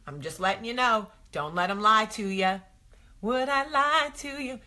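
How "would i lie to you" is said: high, put-on innocent voice; a hint of a sly husband; mock innocent husband. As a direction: play the fibbing husband.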